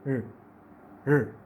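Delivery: र said alone as a short sound; r sound demo. Shown as a demonstration. Teacher isolating the र sound for students. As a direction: give the bare र sound. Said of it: "आर की sound किस तरह से pronounce करेंगे".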